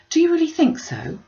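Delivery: a quick lift of hope breaking through worry; hopeful cry. A narrator's reading. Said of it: "cried Elizabeth, brightening up".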